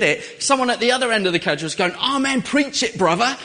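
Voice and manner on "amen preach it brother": higher, louder gospel call-out; mock preacher cheer. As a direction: cheer like a church congregant. Passenger cheering from far end.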